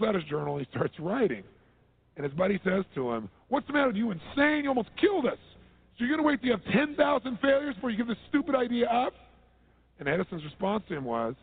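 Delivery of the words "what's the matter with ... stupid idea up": high, loud, exasperated friend berating; incredulous scolding. Retold or imitated. Shaken friend yelling at Edison after the blast, exasperated. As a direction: yell at him like he's lost his mind.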